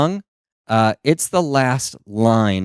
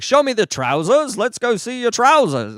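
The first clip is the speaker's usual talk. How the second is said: high, hammed-up London accent; mimicked London accent; 12 semitones higher than usual. Comedian unable to stop copying the greeter, high London accent. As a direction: keep helplessly parroting London speech. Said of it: "how to get around stopping this".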